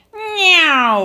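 high loud cat meow; Baby Bear playing cat. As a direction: mew like a kitten. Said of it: "went Baby Bear".

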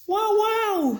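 very high, astonished double wow; a little owl bursting with surprise; amazed owl. A narrator's reading. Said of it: "said the owl".

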